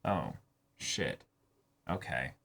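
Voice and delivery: quiet, low "oh shit, okay"; dropping the voice, resigned; muttered realization. Retold or imitated. Sunahara quietly taking in the news, low and soft. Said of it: "his friend of, like".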